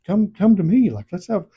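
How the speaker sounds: lightly acted plea to come closer; mimicking a man calling after someone; imitated calling out. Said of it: "he's like".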